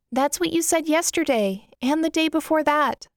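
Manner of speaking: put-on a touch of worry, pitched young; worried little sister. Little sister scolding Kyra for hiding, high worried voice. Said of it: "she responds worriedly".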